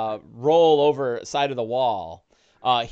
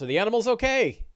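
worried rancher; 8 semitones higher, faster than usual. Acted.

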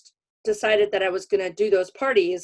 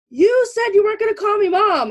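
much higher, louder complaining mom voice; indignant mom; 13 semitones higher, 6 dB louder than usual. Retold or imitated. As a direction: squeak out the mother's hurt protest. Mom complaining about being called mom.

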